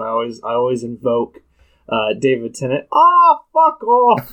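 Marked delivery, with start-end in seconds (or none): 2.9-4.3 slow, loud mock Scottish accent; bad Scottish accent, drawn out. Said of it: "when I try to do a Scottish accent".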